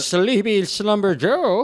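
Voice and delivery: slow, high mocking announcer voice; mocking news-anchor nickname, drawn out; Fox News mockery. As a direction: sneer it like a cable news taunt, slowly. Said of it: "Fox News is always like".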